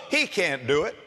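ordinary talk

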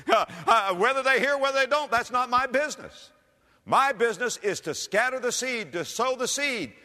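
high, driving declaration; forceful preaching. Real, not acted. Preacher declaring his business is sowing seed, forceful. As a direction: hammer it home with lifted voice.

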